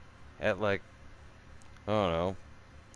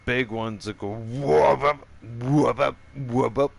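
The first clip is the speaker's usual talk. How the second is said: frog call imitation; 2 semitones higher, 5 dB louder than usual. Shown as a demonstration. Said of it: "like that one".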